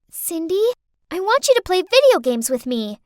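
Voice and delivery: put-on high little-girl request; pestering child. Girl pulls carer's shirt to play video games, pestering. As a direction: ask like a kid tugging for attention.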